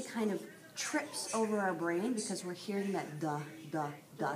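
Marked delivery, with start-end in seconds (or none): 3.2-4.4 "duh, duh, duh" as separate short beats; repeated D sound. Shown as a demonstration. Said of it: "sound again".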